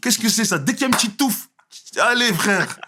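high, loud mock of a scolding father; hammed-up dad scolding the boy's hair; strict dad. Father scolding his son about his hair, hammed-up. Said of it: "Tu connais le daron".

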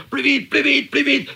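panicked official urging speed; frantic shout. Retold or imitated. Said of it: "L'huissier qui criait très, très fort".

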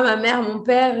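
ordinary talk

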